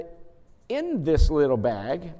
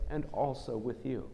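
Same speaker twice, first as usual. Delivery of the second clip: congregation reply, said much softer; soft response; 2 semitones lower, 8 dB quieter than usual. Shown as a demonstration. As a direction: drop your voice for the reply. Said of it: "you, in response, say".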